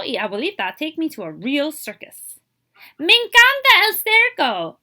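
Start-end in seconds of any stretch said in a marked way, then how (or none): 3.0-4.8 higher, louder, thrilled exclamation; delighted child. A narrator's reading. Child at the circus tells Abuelito, delighted. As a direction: burst out with joy at the circus.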